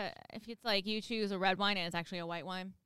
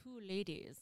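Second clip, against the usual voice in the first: mock Russian accent, faintly put on; 9 dB quieter than usual. Naming a pretend vodka brand for her podcast co-host, light Russian accent. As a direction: say it like a half-hearted Russian. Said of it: "Is that your Russian accent".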